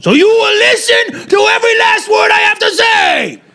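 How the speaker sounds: high, sustained comic shouting; hammed-up screaming impression; Adam Sandler. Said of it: "that was Adam Sandler".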